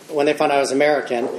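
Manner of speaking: normal talking voice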